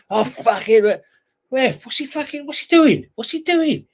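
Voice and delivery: high, loud outraged yell at the referee; shouting at the ref. Retold or imitated. Player yelling at the referee about a foul, outraged loud yell. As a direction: yell it across the pitch, outraged.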